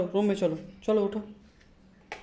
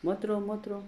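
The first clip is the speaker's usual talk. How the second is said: soft high cooing; 7 semitones higher, 4 dB quieter than usual. Real, not acted. Parent hushing crying baby, soft high coo. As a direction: coo it gently, pitched up.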